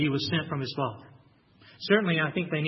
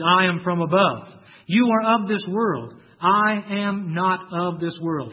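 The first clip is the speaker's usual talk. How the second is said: emphatic declaration; 6 dB louder, slower than usual. Acted. Preacher voicing Jesus' declaration.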